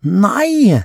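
put-on very high little-girl shriek; child's squeal. A narrator's reading. Mia refuses her brother in play, high squeal. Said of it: "skriker Mia og hyler så det skjærer i ørene".

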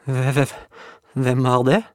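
high stammering French cook, scared; trembling voice. Acted. Juliette hearing footsteps in the hall, trembling. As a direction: stutter out the question in fright. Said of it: "spurte Juliette med skjelvende stemme".

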